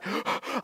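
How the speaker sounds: sharp mock gasp for air; acted breathless gasp; out-of-breath gasp. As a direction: gasp as if winded from panic. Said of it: "I'm out of breath".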